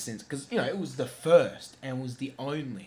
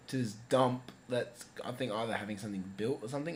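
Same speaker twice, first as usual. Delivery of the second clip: normal talking voice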